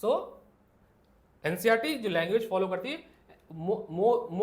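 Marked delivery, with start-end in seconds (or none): none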